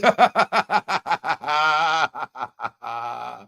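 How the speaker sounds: loud rolling belly laugh; big laugh. Real, not acted. Laughing out loud at the wife photo. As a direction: laugh hard at the wife picture. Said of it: "That's the reason why I'm laughing like that".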